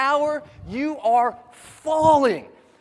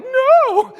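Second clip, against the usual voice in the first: high, loud acted wail of no; panicked refusal; 13 semitones higher, 10 dB louder than usual. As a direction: cry out in mock terror at the jump. Panicked refusal at plane door.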